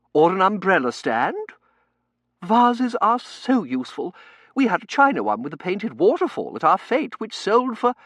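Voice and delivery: high, loud society matron; gushing committee lady's voice; effusive Mrs Meering. Acted. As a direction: urge it brightly at full projection.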